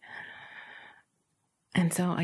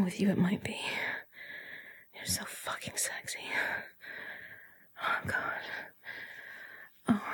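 breathy, gasping delivery with sighs; swooning, breath-heavy murmur; breathless arousal; 3 semitones higher, 3 dB quieter than usual. Acted. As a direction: sigh between phrases, voice fading to whisper. Acted rising arousal in the story.

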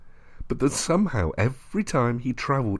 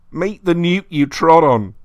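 higher, louder mocking voice; taunting; 7 semitones higher, 7 dB louder than usual. A narrator's reading. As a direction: jeer at him. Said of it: "said the voice".

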